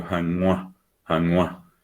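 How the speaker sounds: janua with ngw through the nose; nasal G. Shown as a demonstration. Teacher repeating his correct form, janua with nasal G. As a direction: nasalise the middle of janua, like hangwa. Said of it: "Igual que yo digo".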